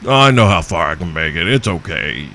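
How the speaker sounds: 1 smug driver. Retold or imitated. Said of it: "You're like".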